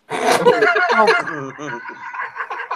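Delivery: airless high laugh; real laughing; breathy high laugh. Real, not acted. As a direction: wheeze with laughter. Laughs at own joke.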